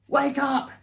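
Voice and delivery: loud, high alarm call; full-voiced cry of alarm; urgent shout. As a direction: shout to rouse sleepers. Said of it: "he shouted".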